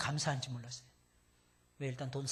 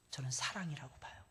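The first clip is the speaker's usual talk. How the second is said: whisper; 3 semitones lower, 8 dB quieter than usual. Acted. Hushed sermon answer.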